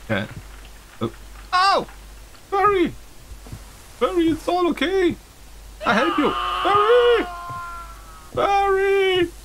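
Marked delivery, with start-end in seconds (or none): 1.5-9.3 desperate high yelling for Barry; calling out. Acted. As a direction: shout for him across the room, panicked.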